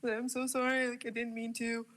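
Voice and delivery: a hammed-up sob-story apology, voice lifted; retold teary apology. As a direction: plead sorry like you're about to cry. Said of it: "I was in tears on voice notes".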